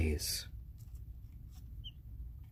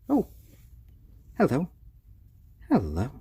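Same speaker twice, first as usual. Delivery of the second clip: high, loud squealed greeting; 1 excited hello; 9 semitones higher, 4 dB louder than usual. Real, not acted. Owner greeting her chick, high excited squeal.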